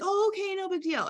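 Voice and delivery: high, meek mock oh okay no big deal; mock the seller who folds too easily; meek give-up. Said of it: "And you're like".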